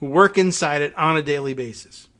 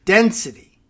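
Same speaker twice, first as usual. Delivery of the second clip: "density" said higher and louder, the correct word set against "destiny"; 6 semitones higher, 5 dB louder than usual. Real, not acted. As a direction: lean on "density" to fix the misreading. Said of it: "What makes it have destiny?".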